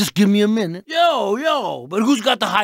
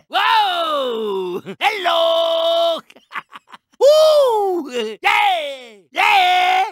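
high shouted cheers with a laugh; excited whoops; 7 semitones higher, much slower than usual. Acted. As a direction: whoop it up like you just won. Cheering after a win.

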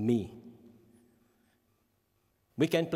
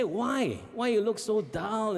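puzzled parent; 8 semitones higher, slower than usual. Retold or imitated. Parent questioning son, high.

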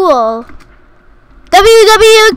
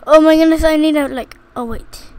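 ordinary delivery

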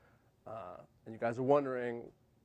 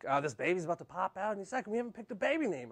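fast, higher-pitched worried wife; quick, pitched-up mock urgency; urgent wife impression; 5 semitones higher, faster than usual. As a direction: imitate a wife rushing to get it decided. Imitates wife before the birth.

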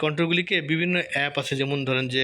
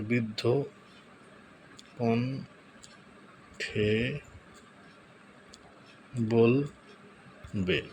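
very slow, long gaps between words; slow dictation; 3 semitones lower, 4 dB quieter, much slower than usual. Real, not acted. Tutorial host typing the last prompt, slow dictation. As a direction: draw out each word while typing.

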